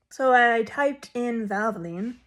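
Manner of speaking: usual speaking voice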